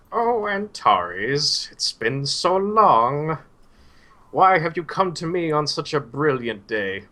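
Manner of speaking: put-on high, very slow frail old man's voice; Suhail, gingerly old man. Old teacher greeting his returning student, high and very slow. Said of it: "a very kind of gingerly nice old man".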